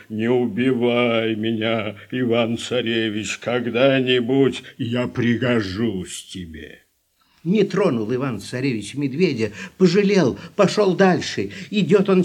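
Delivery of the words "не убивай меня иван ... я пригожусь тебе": low bear voice. Acted. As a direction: speak like a big gentle bear. Bear speaks in human voice.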